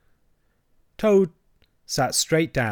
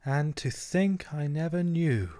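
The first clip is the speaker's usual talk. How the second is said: soft slow dreamy monotone; 4 semitones higher, 5 dB quieter, slower than usual. A narrator's reading. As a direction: drift off in a dreamy drone. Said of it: "in a dreamy monotone".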